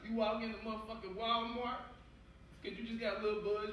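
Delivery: normal talking voice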